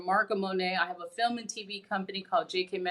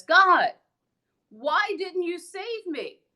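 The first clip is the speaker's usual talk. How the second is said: higher, slow, hurt plea; man pleading in heaven; 9 semitones higher, 5 dB louder, slower than usual. Retold or imitated. Drowned man asking God in heaven why, higher and slow. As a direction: ask God why he was left to drown. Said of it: "he says".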